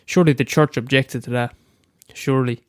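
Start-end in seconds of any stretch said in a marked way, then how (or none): none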